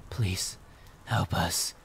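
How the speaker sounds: soft ghostly plea; hushed plea from a lost spirit; understated whisper. A narrator's reading. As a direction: plead softly, almost whispering. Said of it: "one whispered".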